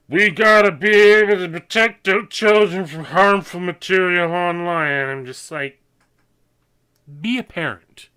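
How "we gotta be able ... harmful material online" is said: loud, preachy mock official; pompous official voice. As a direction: parody a self-righteous lawmaker. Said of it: "It's just like".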